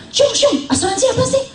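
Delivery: mock-puzzled old father asking; puzzled father-in-law. Father-in-law asking his son about insurance, high and laughing.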